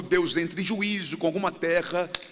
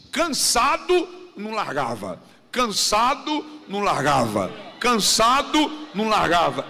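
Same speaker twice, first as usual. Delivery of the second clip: rising, louder each time, slow; preacher builds to a shouted refrain; building shout; 4 dB louder than usual. Acted. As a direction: hammer the phrase home, building to a shout. Preacher repeating the key phrase.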